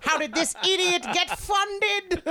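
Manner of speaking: high, slow indignant cartoon villain. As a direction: sneer like an outraged supervillain. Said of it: "de facto villain voice".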